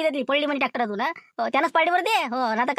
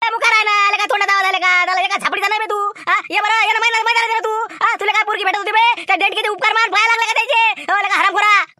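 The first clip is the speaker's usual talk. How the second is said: louder, taunting dare; angry challenge; 3 semitones higher, 4 dB louder than usual. Acted. Brother-in-law daring his rival to fight, angry taunt. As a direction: dare him to come at you.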